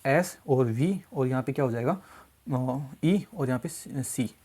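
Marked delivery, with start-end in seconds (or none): 0.1-1.0 slow spelling out. Shown as a demonstration. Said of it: "इससे समझ क्या बोला".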